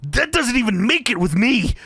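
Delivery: hammed-up wrestler comeback; Macho Man. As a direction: throw it back like an indignant wrestler. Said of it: "Macho Man's response to that is".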